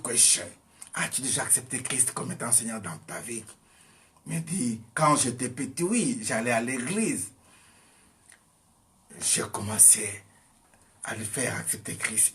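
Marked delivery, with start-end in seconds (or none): none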